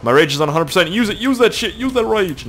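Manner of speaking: loud, high rallying cry; fired-up urging. Pretend. Gamer urges himself to use his rage, loud fired-up cry. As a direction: egg yourself on at full pitch.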